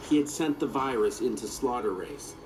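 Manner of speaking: ordinary delivery, nothing marked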